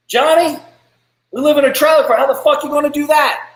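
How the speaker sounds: high, loud incredulous scoff; scoffing naysayer. Retold or imitated. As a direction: shoot the dream down in disbelief. Mocked trailer-park naysayer.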